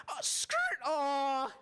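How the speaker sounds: a jolt of retold panic, shouted high; panicked yelp. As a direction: yelp it out as the car scrapes again. Retold panic as car scrapes.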